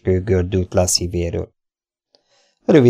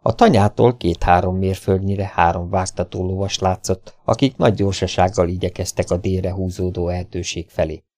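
usual speaking voice

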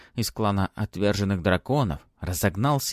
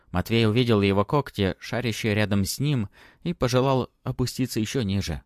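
usual speaking voice

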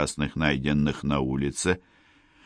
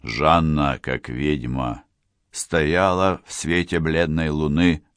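plain reading voice

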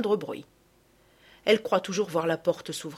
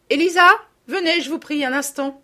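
elle appelle la domestique, haut et fort; 8 semitones higher, 8 dB louder than usual. A narrator's reading. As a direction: lancer le nom à travers la pièce. (appel sonore vers Élisa dans la pièce voisine)

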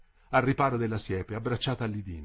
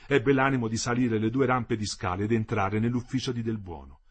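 ordinary delivery, nothing marked

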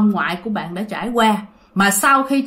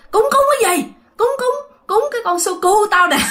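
angry yell; 11 semitones higher than usual. Retold or imitated. Grandpa yelling at grandma angrily.

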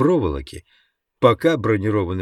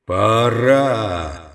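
roar. Acted. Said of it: "взревел голос".

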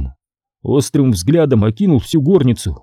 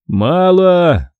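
raised, curt refusal; curt scribe; 6 semitones higher than usual. Acted. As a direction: snap that it's not enough.

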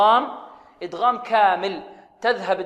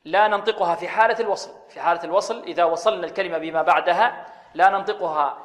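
normal talking voice

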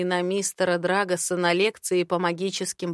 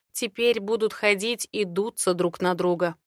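ordinary delivery, nothing marked

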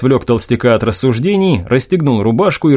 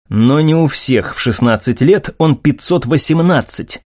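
ordinary delivery

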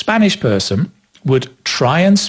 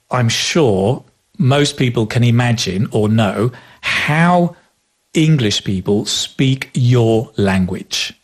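usual speaking voice

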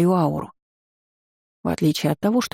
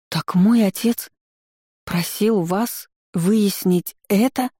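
stunned realisation; 3 semitones higher, slower than usual. A narrator's reading. Shocked heroine realising.

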